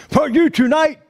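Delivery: high-pitched emcee introduction; show announcer. Retold or imitated. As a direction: announce the act in a high, ringing voice.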